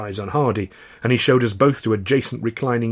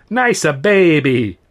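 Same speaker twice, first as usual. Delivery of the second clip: slow, higher, louder coo; cooing barber; 5 semitones higher, 6 dB louder, slower than usual. A narrator's reading. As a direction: coo it again with more relish, slowly. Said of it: "he cooed".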